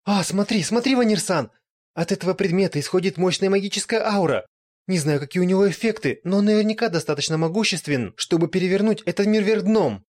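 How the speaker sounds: high, loud thrilled gasp; excited lich. Acted. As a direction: gush over the loot. Lich excited by magic item.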